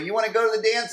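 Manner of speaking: put-on high girlish voice asking; imitating a girl. Imitating a schoolgirl asking Eric to the dance, high and sweet. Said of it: "She was like".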